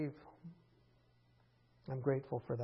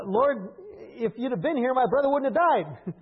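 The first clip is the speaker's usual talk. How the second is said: louder, higher accusing cry; 8 semitones higher, 8 dB louder than usual. Retold or imitated. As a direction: throw the blame at him. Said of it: "Mary just accuses him".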